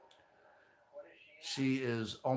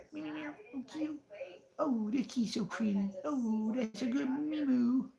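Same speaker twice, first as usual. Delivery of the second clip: put-on baby talk to cat; 13 semitones higher than usual. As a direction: coo at the kitty like a baby. Cooing to the cat.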